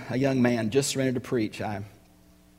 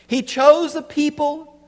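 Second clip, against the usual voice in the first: preaching at full force; 12 semitones higher, 8 dB louder than usual. Real, not acted. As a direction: proclaim each word from the pulpit.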